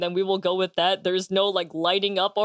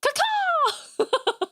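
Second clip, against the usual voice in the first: happy bird voice; much slower than usual. Pretend. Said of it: "She's like".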